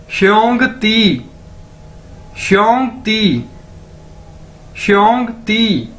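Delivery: श्योंग ती said slowly, shyong ti; slow, careful teacher demo; slow drill. Shown as a demonstration. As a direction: join श्योंग ती slowly for the class. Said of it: "इकट्ठा कर दें".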